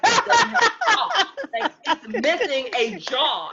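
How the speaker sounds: high, laughing through the words; half laughing, half horrified; laughing in disbelief. Real, not acted. Telling a friend about the jawless dog, high laughing disbelief.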